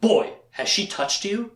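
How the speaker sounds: put-on slow, urgent old-man question; lightly voiced anxious mentor; worried Zed. Recapping the show, speaker voices Zed questioning Richard. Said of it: "and he's like".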